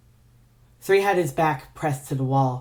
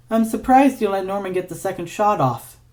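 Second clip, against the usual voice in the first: mocking; 7 semitones higher, 3 dB louder than usual. Ignatius mocking Three's mistake.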